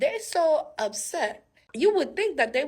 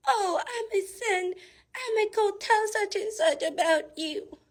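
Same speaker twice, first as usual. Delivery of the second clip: high, put-on tattling voice; hammed-up, high-pitched taunt; mocking threat; 11 semitones higher than usual. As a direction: mimic a petty online threat. Mocking someone's online threat.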